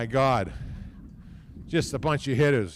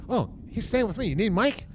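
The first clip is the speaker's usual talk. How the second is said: imitating host mom; 8 semitones higher than usual. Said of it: "She goes".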